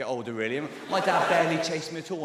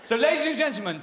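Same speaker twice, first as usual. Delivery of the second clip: high, loud show-opening announcement; announcer call; 9 semitones higher, 5 dB louder than usual. Real, not acted. Comic starting his own stage introduction, announcer call. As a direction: launch the introduction with a raised voice.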